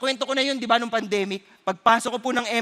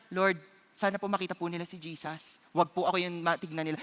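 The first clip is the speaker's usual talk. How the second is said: much softer, lower whispered prayer; hushed prayer; 6 semitones lower, 9 dB quieter than usual. Retold or imitated. Preacher praying for his bashers, hushed prayer. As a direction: pray it quietly and humbly.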